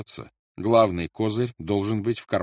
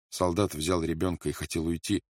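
usual speaking voice

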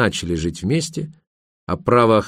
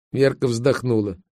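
plain reading voice

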